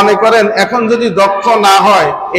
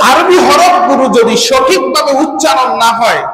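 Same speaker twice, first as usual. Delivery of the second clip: ordinary talk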